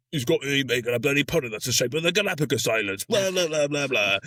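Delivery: hammed-up moaning crewman below decks; grumbling steward. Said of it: "who's going".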